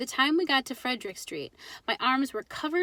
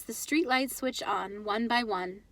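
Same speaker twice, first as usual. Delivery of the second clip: ordinary delivery, nothing marked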